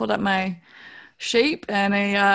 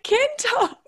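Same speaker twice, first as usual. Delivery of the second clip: high, teary shock of recognition; surprised squeal; much slower than usual. Retold or imitated. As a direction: gasp it out, overwhelmed. Shocked at recognizing her brother.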